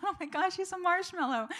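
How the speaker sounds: a thrilled, affectionate exclamation; delighted surprise. Retold or imitated. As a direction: squeal it like you just found out he's a softie. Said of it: "And I thought".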